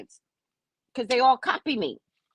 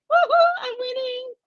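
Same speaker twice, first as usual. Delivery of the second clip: very high gleeful whoop; victory whoop; 14 semitones higher than usual. Real, not acted. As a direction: cheer your win. Victory cheer.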